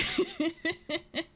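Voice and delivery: high-pitched giggle. Real, not acted. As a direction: burst of giggling. Laughing at own growl.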